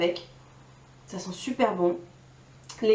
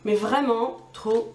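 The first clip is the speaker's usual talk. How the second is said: ordinary delivery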